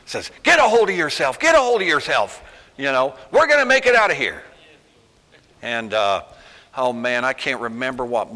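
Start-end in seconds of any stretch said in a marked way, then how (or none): 0.4-4.4 stern wake-up call. Acted. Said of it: "Slap their face and knock 'em back into their senses".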